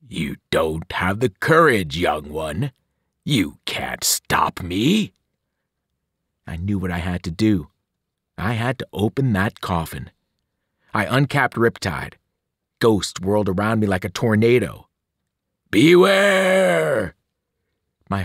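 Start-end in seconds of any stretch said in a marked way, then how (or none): 0.1-5.1 low, slow, menacing whisper at the end; taunting evil titan, lower and slow; cold villain voice. Acted. Kronos taunting from below. 15.7-17.1 higher, louder drawn-out warning; long loud ghostly cry; ghostly shout. Acted. Ghosts whirl, crying warning.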